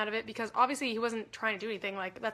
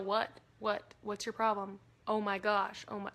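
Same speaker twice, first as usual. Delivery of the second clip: ordinary delivery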